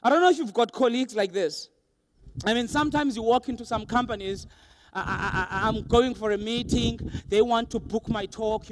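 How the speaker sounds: usual speaking voice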